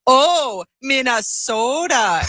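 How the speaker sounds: high, drawn-out mock Minnesota accent. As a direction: tease with an exaggerated long O, Minnesota-style. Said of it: "and they'd go".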